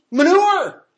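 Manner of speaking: very high, loud MANURE, drawn out; comic exclamation. Acted. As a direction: blurt out the punchline with mock revulsion. Punchline of the filthy-garments quote.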